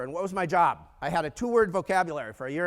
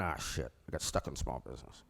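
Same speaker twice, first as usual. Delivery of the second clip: low, quiet glum grumble; retold dejection; 8 dB quieter than usual. Colleagues stuck in small business, low gloomy moan. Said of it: "everybody else is sitting there going".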